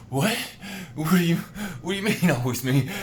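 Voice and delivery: high, loud confused questioning; pushing back in disbelief; loud high bewildered what; baffled protest. Retold or imitated. Confused reply to the voice.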